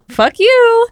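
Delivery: mock fuck you. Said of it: "She basically was just like".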